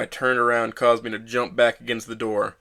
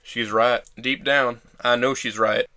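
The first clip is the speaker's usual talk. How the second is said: ordinary delivery, nothing marked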